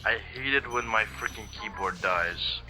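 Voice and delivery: usual speaking voice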